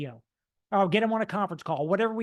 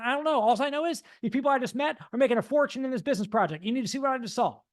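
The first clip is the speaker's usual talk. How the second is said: higher, fast, eager rush; overly excited; 4 semitones higher, faster than usual. Retold or imitated. Telling a friend on the phone, high and urgent. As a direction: spill it out like big news. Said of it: "Was I talking a little fast there?".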